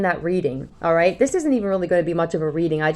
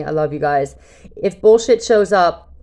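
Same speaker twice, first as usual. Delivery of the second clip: normal talking voice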